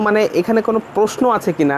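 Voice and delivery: ordinary talk, nothing marked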